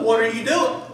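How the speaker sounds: loud alarmed outcry; freaked-out outburst. Retold or imitated. Pastor imagining freaking out at the crowd, loud alarmed cry. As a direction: blurt it out in sudden alarm. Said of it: "I'll be the first one to freak out".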